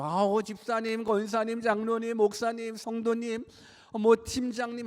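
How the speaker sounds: high, chummy roll call of titles; imitated church greetings. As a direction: mimic members greeting each other. Mimics church members greeting one another.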